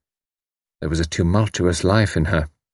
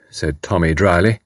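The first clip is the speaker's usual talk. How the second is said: plain reading voice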